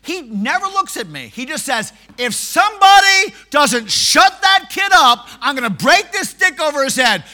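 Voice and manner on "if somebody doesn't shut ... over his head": threatening bellow. Retold or imitated. Pool player threatening the young preacher, loud and angry. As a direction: threaten to break the stick over the kid.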